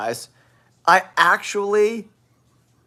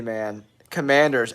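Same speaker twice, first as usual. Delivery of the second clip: normal talking voice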